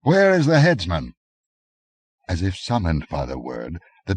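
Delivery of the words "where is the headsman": higher, louder impatient call. A narrator's reading. Nemedian noble calling for the headsman, impatient and loud. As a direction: demand the headsman now. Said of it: "he spoke with a Nemedian accent".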